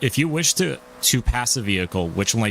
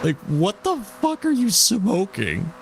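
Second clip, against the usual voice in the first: incredulous; 12 semitones higher, slower than usual. Real, not acted. Mocking the proctor's answer.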